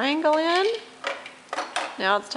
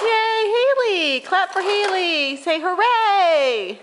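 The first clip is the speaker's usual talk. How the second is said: cheering; 8 semitones higher, slower than usual. Real, not acted. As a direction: cheer her on like she won a prize. Mother cheering toddler's success.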